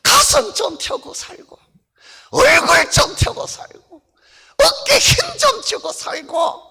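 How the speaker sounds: high, bold little puppet boasting. Acted. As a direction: brag proudly as the puppet doll. Puppet urges congregation. (당당하게 자랑하는 높은 인형 목소리)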